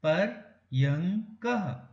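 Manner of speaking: पर यंग कह broken into syllables, slow; slow syllable demo. Shown as a demonstration. Teacher sounding out पर्यङ्कः slowly for children. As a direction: spell out पर यंग कह syllable by syllable, यंग nasal. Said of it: "य को नाक, नाक से बोला".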